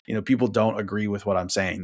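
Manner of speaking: ordinary delivery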